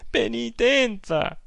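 Penitenza! stretched slow and high, mocking; mocking drawn-out echo. Aunt mimicking the priest's command, Penitenza! slow and high. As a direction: drag out Penitenza! in bitter mockery.

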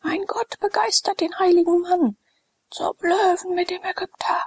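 high, frenzied mob cry; crowd yelling. A narrator's reading. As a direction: howl for his death. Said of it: "Mit diesem Geschrei".

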